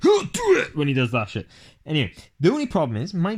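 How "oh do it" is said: high, loud "Oh, do it!" shout; Shia LaBeouf impression. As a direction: yell it like the motivational meme. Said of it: "It's Shia LaBeouf going".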